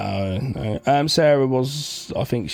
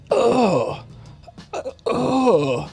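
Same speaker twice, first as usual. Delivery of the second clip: mock wail; 11 semitones higher than usual. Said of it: "It's like".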